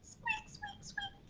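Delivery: tiny, very high soft squeaks; mouse squeaks. A narrator's reading. Storyteller voicing the mouse for toddlers, tiny squeaks. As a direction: squeak softly like a little mouse. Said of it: "says the mouse".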